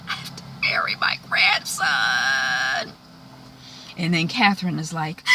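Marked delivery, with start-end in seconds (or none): 0.1-2.9 breaking with grief. Retold or imitated. Hannah must bury her grandson, breaking with grief. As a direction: voice collapsing into a slow whispered wail.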